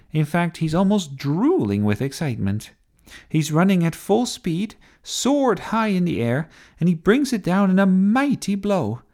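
excited play-by-play, rising in pitch; TV commentary. A narrator's reading. Sophie calling the knight's charge for TV, excited commentary. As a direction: build toward the big blow. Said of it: "doing the commentary for T V".

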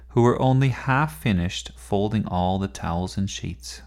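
ordinary delivery

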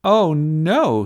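loud, high cry of alarm; dismayed shout. A narrator's reading. Sophie seeing Jasmine lose her sword, dismayed shout. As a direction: cry out at the disaster. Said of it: "shouted Sophie".

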